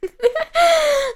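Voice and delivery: high-pitched crazed laughter; mad laugh. A narrator's reading. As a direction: burst into an acted mad laugh. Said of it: "si mise a ridere, follemente". (risata folle)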